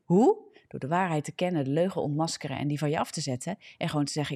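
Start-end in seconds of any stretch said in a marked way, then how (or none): 0.0-0.4 loud rhetorical question. Real, not acted.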